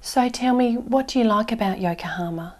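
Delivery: ordinary talk, nothing marked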